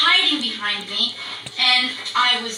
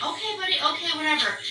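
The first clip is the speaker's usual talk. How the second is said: offhand placating; 4 semitones higher, 6 dB quieter, faster than usual. Retold or imitated. Placating the screaming dog.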